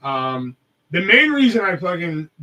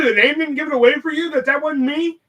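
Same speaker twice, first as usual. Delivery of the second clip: exasperated disbelief; 11 semitones higher, 5 dB louder than usual. Retold or imitated. Exasperated retort to Brendan.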